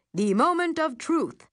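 high, booming mock-announcer reveal; radio announcer voice. A narrator's reading. Narrator unveils the TV test, mock radio announcer. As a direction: proclaim it like a radio host. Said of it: "in my radio announcer voice".

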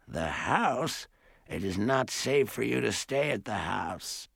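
put-on softened elderly warning voice; great-aunt, troubled. A narrator's reading. Great-aunt warns visitors about the house, troubled. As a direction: warn them softly in an old-lady voice.

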